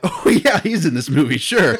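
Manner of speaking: high, loud mock surprise, dripping sarcasm; sarcastic. Host recalling his reaction in the theater, sarcastic high voice. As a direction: fake delight at a forgotten hero. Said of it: "And I'm like".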